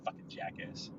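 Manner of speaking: unvoiced under-breath curse; muttered curse. Real, not acted. Driver curses the other car quietly. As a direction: swear at him under your breath.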